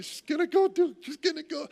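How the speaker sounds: high, fast, fussy imitation of the wife; mimicking wife. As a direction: squeak it out like a fussing wife. Husband mimics wife getting ready.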